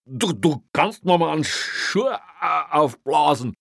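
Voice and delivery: high, loud, halting outburst; agitated stutter. A narrator's reading. Insulted burglar retorts to the policeman, stuttering with agitation. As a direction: stammer out the insult, words sticking. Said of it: "Der Mann stotterte vor Erregung".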